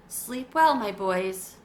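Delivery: ordinary delivery, nothing marked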